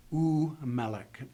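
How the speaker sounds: u melech, slow, the u as its own syllable before melech; separate u. Shown as a demonstration. Teacher shows students the separate u, slowly. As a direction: say u, then melech, with a clear break. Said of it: "Pronounce the u by itself".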